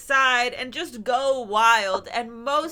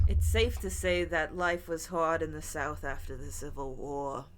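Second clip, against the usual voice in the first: lower, softer storyteller read; story narration; 7 semitones lower, 6 dB quieter than usual. Acted. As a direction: read it like a campfire tale. Reads a spooky folklore segment.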